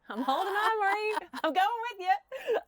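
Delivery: high, teary laugh while talking; choked up and laughing at once; laughing through tears. Real, not acted. Emotional thanks to Marie.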